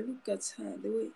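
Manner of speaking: usual speaking voice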